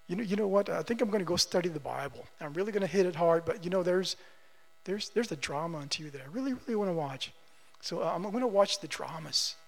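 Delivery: low, soft musing to oneself; sheepish self-talk. Acted. Believer choosing TV dramas over Bible study, quietly sheepish. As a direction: talk yourself out of it under your voice.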